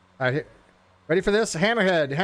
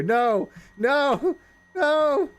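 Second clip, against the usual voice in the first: panicked no; 10 semitones higher, much slower than usual. Real, not acted. Plane plunging, losing control.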